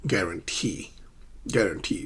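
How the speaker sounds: "guarantee" as guaran-TEE, falling; low, careful guaran-TEE; stress on final syllable. Shown as a demonstration. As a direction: save the weight for TEE in "guarantee". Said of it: "and then the final syllable".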